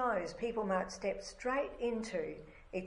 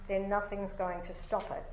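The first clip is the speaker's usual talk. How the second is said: ordinary talk, nothing marked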